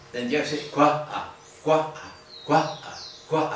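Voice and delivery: "cuá" split by a small cough-like catch between the two a's; "ah, cuá" with a glottal stop, like a little cough. Shown as a demonstration. Teacher demonstrating the glottal stop in "cuá". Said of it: "así tiene que ser".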